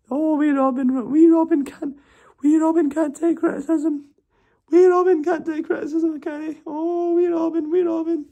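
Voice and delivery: very high falsetto mock sympathy; baby him sweetly to needle him; patronizing coo. Friend mocking Robin for not taking criticism in very high falsetto. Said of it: "to be patronizing and to wind me up".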